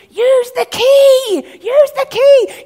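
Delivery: high, loud, drawn-out yell; screaming at the screen. Retold or imitated. Family yelling at a film character facing a big foe, screaming. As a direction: yell it desperately at the hero on screen. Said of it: "our whole family is screaming at the screen".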